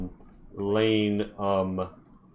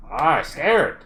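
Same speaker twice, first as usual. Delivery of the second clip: scoffing; 6 semitones higher, 7 dB louder than usual. Acted. Dwarf scoffs at dare.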